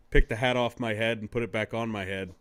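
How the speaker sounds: ordinary delivery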